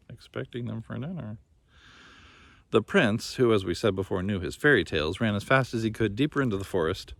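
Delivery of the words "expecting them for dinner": hushed, darkly knowing repeat; ominous echo. A narrator's reading. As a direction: softly echo the chilling line.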